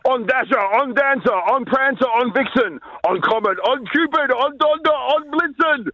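high, ringing Santa call; reindeer roll call. Acted. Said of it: "let's hear your audition to be Santa".

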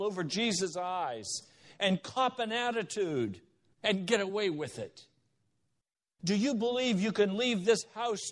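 high, driving preacher's call, pressing every phrase; fiery preaching. Acted. Pastor challenging the church about walking with Ahab, preaching hard. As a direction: hammer the question home to the congregation.

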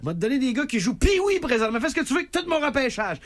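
high, laughing caricature of a greedy manager; mock eager general manager. Voices the rival GM's demands.

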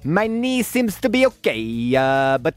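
put-on Italian accent. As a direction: overplay an Italian driver listing his aches. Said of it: "The Italian stallion".

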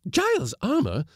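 wife recognising her father's name, crying out; cried out. A narrator's reading. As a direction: blurt the name in astonishment. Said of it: "cried out".

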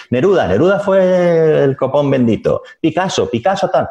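a hammed-up schoolroom rave; mock enthusiastic teacher. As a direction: gush like a teacher selling famous names. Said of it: "llevar al colegio y decir".

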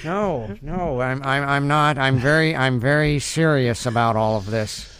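a hint of earnest stammering Marty; Jay Baruchel as Marty. Marty as Baruchel insists to Doc he's serious. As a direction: insist nervously, stumbling over words. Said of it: "Jay Baruchel is Marty McFly".

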